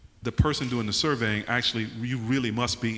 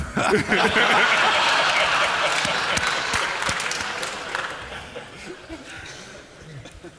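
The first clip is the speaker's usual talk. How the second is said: long airy laugh; breathy laugh; 8 semitones higher, 4 dB louder than usual. Real, not acted. Laughing after the impression.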